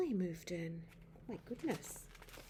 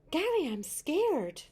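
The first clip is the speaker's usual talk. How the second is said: higher, louder, slow frightened cry; scared; 7 semitones higher, 7 dB louder, slower than usual. Acted. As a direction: plead it, frightened. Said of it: "said the woman".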